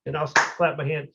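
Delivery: ordinary talk, nothing marked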